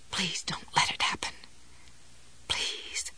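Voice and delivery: pleading in a fading whisper; desperate whisper. A narrator's reading. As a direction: beg under your breath. Desperate plea at the shelf.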